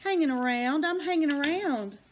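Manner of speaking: high, loud cartoon duck voice; playful pet voice speaking for the duck; voicing the duck. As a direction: answer for the pet in a silly voice. Voicing the duck's answer.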